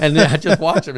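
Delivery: laughing through the words; can barely keep from laughing. Real, not acted. Laughing while telling punch.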